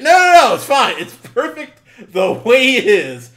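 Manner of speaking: high, loud mock insistence; smug dismissal. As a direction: brush off any rewrite, overconfident. Mocking Tyler Perry's self-approval.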